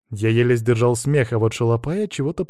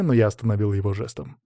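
ordinary delivery, nothing marked

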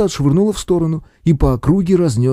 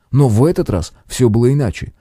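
ordinary delivery

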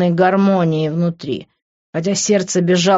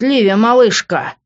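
high, loud call to a loved one; urgent raised-voice call; 6 semitones higher, 5 dB louder than usual. A narrator's reading. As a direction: cry out as you rush to her. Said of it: "закричал я, уже набирая скорость".

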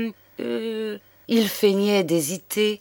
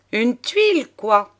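gleeful; 5 semitones higher than usual. A narrator's reading. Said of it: "fit Gévrol radieux".

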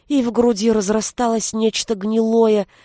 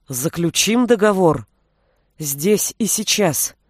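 understated stubborn insistence; упрямо; 2 semitones lower than usual. A narrator's reading. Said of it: "упрямо процедил Милош". (сдержанно, упрямо цедя слова)

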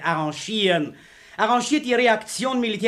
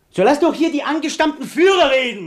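shouting; 6 semitones higher, 5 dB louder than usual. Acted. Shouting over the crowd.

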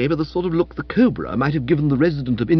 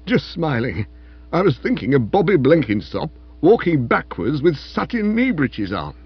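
meek, amused; 3 semitones higher than usual. A narrator's reading. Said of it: "he said meekly".

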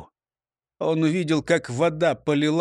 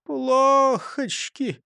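high, very drawn-out sigh of a word; long-stretched dismay; sighing exclamation; 9 semitones higher, much slower than usual. A narrator's reading. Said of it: "вздохнул Густав Гейер".

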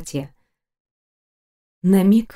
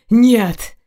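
sudden loud outburst; explosive narrated protest; desperate refusal; 3 semitones higher, 5 dB louder than usual. A narrator's reading. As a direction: cry out in protest, louder. He refuses to let her go.

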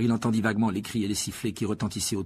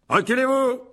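high, loud order; commanding shout; 14 semitones higher, 7 dB louder than usual. A narrator's reading. Lockhart clearing space for his spell, commanding shout.

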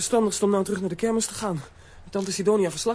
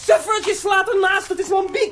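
mocking shout; 11 semitones higher, 6 dB louder, slower than usual. Mocking a missed blow.

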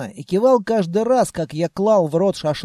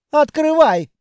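proud command; 7 semitones higher, 4 dB louder than usual. Acted. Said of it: "гордо фыркнул".

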